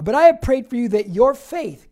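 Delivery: loud, ringing, preached at full force; booming scripture quote. Acted. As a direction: proclaim it to the whole room. Preacher rereads verse, much louder.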